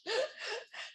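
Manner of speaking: high, soft trailing giggle; real laugh tailing off. Real, not acted. Co-host amused by the pothole joke, laughing. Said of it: "I'm sorry for laughing".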